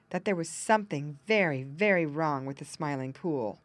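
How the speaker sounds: ordinary delivery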